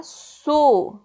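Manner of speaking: "su" said strongly and held long; lone "su", emphatic and drawn out; stressed syllable. Shown as a demonstration. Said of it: "A sílaba tônica está na penúltima".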